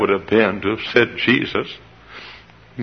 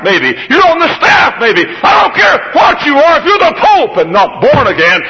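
rising preached shouts; 13 semitones higher than usual. Acted. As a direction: pile them on to a shout. Preaching at full force.

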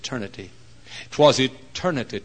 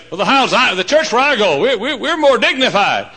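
high, loud mock objection; dignified churchgoer; 12 semitones higher, 12 dB louder than usual. Churchgoer defending their own church, loud high-pitched mock protest. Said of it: "You say".

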